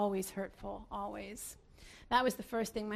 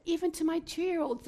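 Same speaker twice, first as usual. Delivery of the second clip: usual speaking voice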